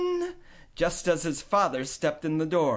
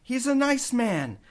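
higher, lightly voiced faltering mother; hesitant mother; 8 semitones higher than usual. A narrator's reading. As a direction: hedge about the new man. Mom hesitating.

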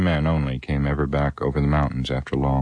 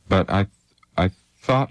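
high, stammering start; stammer, voice jumping up; 5 semitones higher than usual. A narrator's reading. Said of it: "he stammered".

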